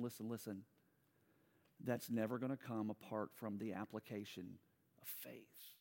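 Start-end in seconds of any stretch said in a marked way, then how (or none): 5.0-5.7 whisper. Real, not acted. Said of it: "whispered word".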